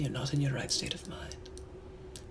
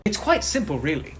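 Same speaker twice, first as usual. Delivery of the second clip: mock exasperated rant; 5 semitones higher, 6 dB louder than usual. Gentleman ranting about low standards.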